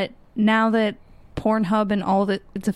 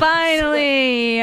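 drawn out finally; 5 semitones higher, 3 dB louder, much slower than usual. Acted. Drawn-out relieved exclamation.

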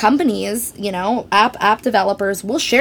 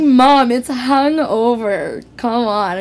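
exasperated correction; 3 semitones higher, 3 dB louder, slower than usual. Retold or imitated. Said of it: "And I'm like".

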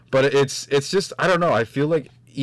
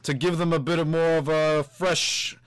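slow, higher put-on celebrity drawl; Christopher Walken impression; 7 semitones higher, slower than usual. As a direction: drag each word out like a quirky film star. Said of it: "Everyone's gonna do a Christopher Walken impression".

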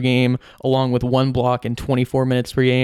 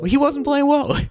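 much higher, put-on mocking voice; mock whiny voice; 14 semitones higher than usual. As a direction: mimic him mockingly, pitched way up. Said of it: "he definitely heard you now".

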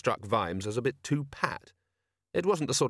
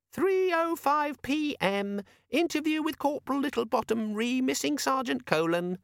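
sulky little demon; 14 semitones higher than usual. A narrator's reading. The demon grudgingly reading Vimes his appointment, sulky squeak. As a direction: drag the reminder out, sulking. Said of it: "said the demon sulkily".

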